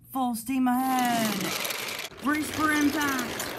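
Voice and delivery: very high, loud charging shout; full-throated high rallying cry; excited battle cry. Acted. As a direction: yell it as the engines charge in. Engines charging into the buffers.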